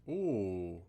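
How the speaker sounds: loud sudden oh; startled gasp. Real, not acted. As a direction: jump at a scare. Startled in horror game.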